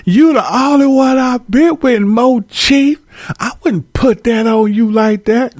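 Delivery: a hint of a woman swearing he's the only one; mildly mocking a cheating partner's plea; lying girlfriend. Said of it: "And he be like".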